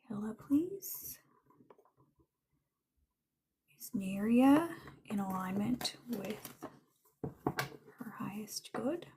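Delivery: breathy whispered plea to a spirit; hushed whisper to her spirit guide. Real, not acted. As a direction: whisper the question softly to the guide.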